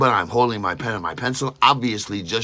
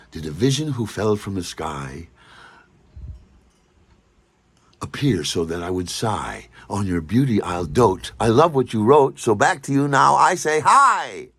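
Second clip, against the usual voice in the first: limerick recital; 4 dB louder than usual. Shown as a demonstration. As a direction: recite it like a love poem. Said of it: "it went like this".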